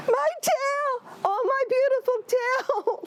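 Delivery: high wailing cry over a lost tail; rabbit crying. Acted. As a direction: wail like a heartbroken little rabbit. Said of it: "And he started crying".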